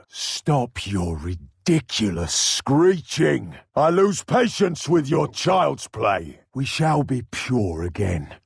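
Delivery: ordinary delivery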